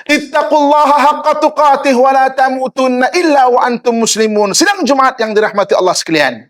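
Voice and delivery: strong, ringing sermon address; firm sermon delivery. Shown as a demonstration. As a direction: proclaim it firmly like a khatib to the congregation. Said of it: "Kalau bercakap benda yang tegas".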